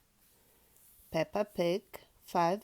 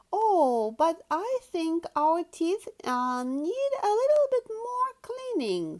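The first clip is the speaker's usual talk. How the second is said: wheedling Peppa; 8 semitones higher, 4 dB louder than usual. Acted. Peppa stalls for time.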